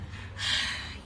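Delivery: long breathy sigh; a defeated porcupine's sigh. Acted. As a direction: let out a weary sigh. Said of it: "he sighed".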